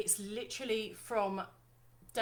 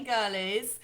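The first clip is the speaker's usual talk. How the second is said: ordinary talk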